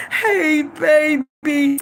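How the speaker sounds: drawn-out mama greeting; imitating mama. Mama who always favors her daughter, drawn-out fond greeting. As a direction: lightly voice mama's fond hello. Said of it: "That's how she always talked to me".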